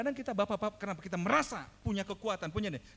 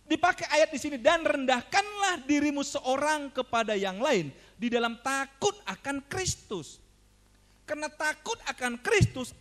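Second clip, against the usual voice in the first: raised, emphatic pulpit delivery; forceful preaching; 10 semitones higher, 5 dB louder than usual. Real, not acted. Preacher quotes commands to wives and husbands, forceful preaching. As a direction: drive each command home to the congregation.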